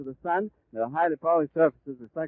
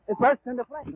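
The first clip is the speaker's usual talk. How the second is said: sudden shocked outcry; 7 semitones higher than usual. Real, not acted. Airship explodes during live report.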